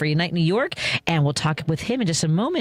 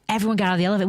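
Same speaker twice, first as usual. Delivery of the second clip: normal talking voice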